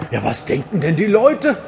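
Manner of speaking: high, comically worried self-talk; mock fretting. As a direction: ham up the clothing dilemma. Himself fretting before the wardrobe. (gespielt besorgtes Selbstgespräch, höher)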